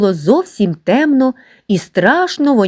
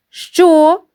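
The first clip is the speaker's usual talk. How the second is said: startled question; 10 semitones higher than usual. Acted. Professor surprised.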